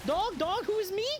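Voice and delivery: very high, squeaky silly voice; silly high dog voice. Pretend. Streamer playing a dog, squeaky silly voice. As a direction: squeal like a goofy cartoon dog.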